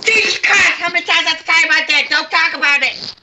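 high, fast furious yelling; angry shout. Acted. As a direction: snap at the kids in fury. Grandma snaps at kids.

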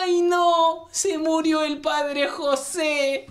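high, very slow falsetto lament; Mother Superior mourns Father José at the punchline; grieving nun. Acted.

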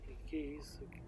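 unvoiced scared whisper. Real, not acted. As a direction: breathe the question. Whispering in dark.

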